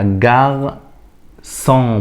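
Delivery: جارسون very slowly, a clear s; soft c as s. Shown as a demonstration. Teacher modelling جارسون for the class. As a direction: stretch جارسون out with s. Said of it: "ما ينفعش أقولها جاركون".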